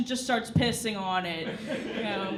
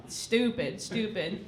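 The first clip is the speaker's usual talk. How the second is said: low, soft muttered stupid, stupid; muttered under breath; 5 semitones lower, 6 dB quieter, slower than usual. Real, not acted. Comic grumbling to herself about mochi, muttered low and soft. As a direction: mutter it to yourself.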